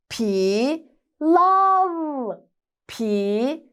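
sounding out words. Shown as a demonstration. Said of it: "Birleştiriyoruz".

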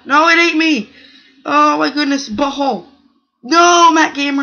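high, loud anguished cry; anguished shout. Real, not acted. Gamer crying out as Mac Gamer eats him, anguished shout. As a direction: yell as you get eaten.

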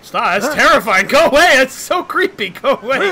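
high, loud frightened shrieking; loud, high-pitched alarm; creeped-out panic. Real, not acted. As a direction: yell at it to go away.

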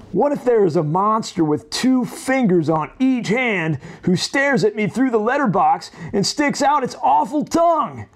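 put-on a nervous boy imagining a monster at the letterbox; fretful Jack voice. Lightly voiced anxious Jumpy Jack listing his fears.

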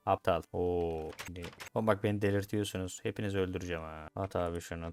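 ordinary talk

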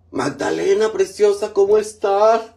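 high, loud, gushing hello; excited greeting. Real, not acted. Gushing hello to a viewer on the live stream. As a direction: greet her like a long-lost friend.

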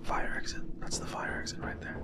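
excited hushed whisper. Real, not acted. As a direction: whisper it, scared of being heard. Spotting exit while hiding.

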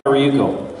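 ordinary talk

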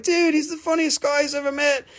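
high mock admiration; imitating delighted viewers. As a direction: gush it up high like a fan. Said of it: "everybody else is like".

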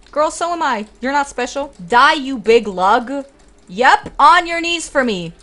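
loud swaggering trash talk at the boss; cocky taunt. Pretend. A gamer taunting the big boss, loud and cocky. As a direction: gloat and boss him around. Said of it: "listening to me get so cocky".

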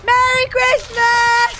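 high, slow, loud holiday shout; loud drawn-out cheer. Real, not acted. Streamer cheering a holiday greeting to chat, loud and drawn out. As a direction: stretch it out like a big festive cheer.

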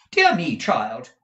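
loud, high, drawn-out surprise; startled exclamation. A narrator's reading. Madame finding Kate in the room, loud startled exclamation. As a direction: recoil with a loud cry. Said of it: "exclaimed Madame Mantalini, recoiling in surprise".

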